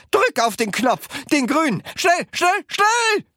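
high, urgent shouted orders; roaring. A narrator's reading. Wonka urging Charlie to hit the button, roaring. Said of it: "brüllte Mister Wonka".

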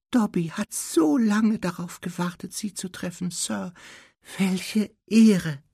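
high, squeaky, awed elf; Dobby. A narrator's reading. House-elf honoured to meet Harry, squeaking in awe. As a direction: squeak with reverence, far up in pitch. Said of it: "mit so durchdringender Piepsestimme".

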